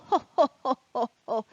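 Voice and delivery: jolly ho ho ho; Santa laugh. Acted.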